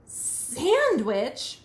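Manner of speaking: loud, drawn-out, rising question; appalled picky eater; incredulous disgust. Acted. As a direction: balk loudly at the offer. Bird balks at Raccoon's sandwich.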